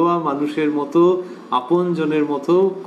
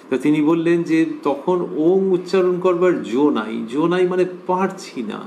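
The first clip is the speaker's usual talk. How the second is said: ordinary delivery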